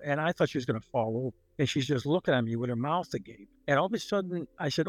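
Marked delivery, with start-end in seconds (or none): none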